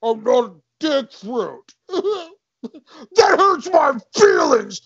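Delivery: loud, high mock wail; hammed-up crying shout; wounded guard. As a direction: wail like a hurt, blubbering guard. Guard wails at the insult.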